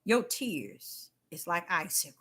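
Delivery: soft, low, steady answer; God answering Deborah softly and firmly; God's calm reply. Retold or imitated. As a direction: quietly refuse to be moved. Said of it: "He said".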